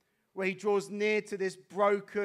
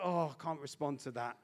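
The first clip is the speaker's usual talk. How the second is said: exhausted; 4 semitones lower, 6 dB quieter than usual. Retold or imitated. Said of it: "I think he's so exhausted".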